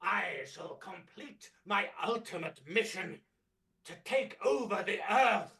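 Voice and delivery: booming villain proclaiming from a throne; booming demon lord. Acted. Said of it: "presence of Doctor Chaotica, ruler of the cosmos".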